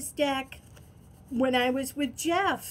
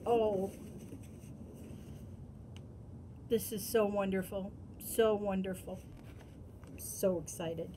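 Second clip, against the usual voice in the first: hushed awe; 4 semitones lower, 9 dB quieter than usual. Real, not acted. Overwhelmed, hushed.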